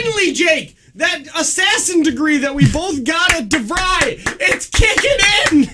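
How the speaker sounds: mock triumphant.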